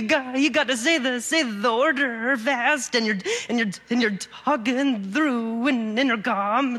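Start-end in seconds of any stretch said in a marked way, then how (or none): none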